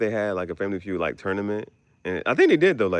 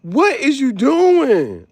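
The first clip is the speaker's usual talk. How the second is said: loud, slow, high scolding; mock angry teammate; 14 semitones higher, 9 dB louder, much slower than usual. Scolding a teammate on the show, loud slow yell. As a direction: drag out the scolding. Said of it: "get mad at your teammates".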